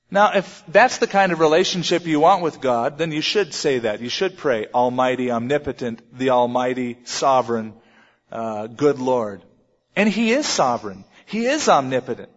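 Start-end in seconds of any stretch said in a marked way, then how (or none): 4.7-9.4 low, monotone string of titles; mock formal prayer. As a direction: intone the titles remotely, pitched down. Mock recital of formal distant prayer.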